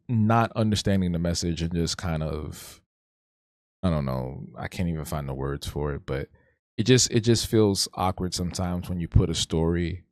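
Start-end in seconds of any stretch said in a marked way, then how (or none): none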